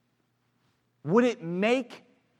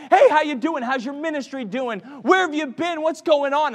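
bright friendly hello, louder and higher; eager greeting; 8 semitones higher, 8 dB louder than usual. Retold or imitated. Preacher imagining greeting friends he hadn't seen. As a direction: greet old friends with full energy. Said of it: "I wanted to be able to talk".

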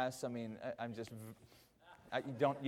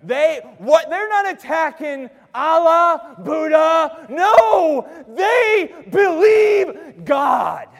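high, very loud, drawn-out pulpit shout; shouted preaching climax; 13 semitones higher, 21 dB louder, much slower than usual. Real, not acted. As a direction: shout each word slowly to the rafters. Sermon climax at full force.

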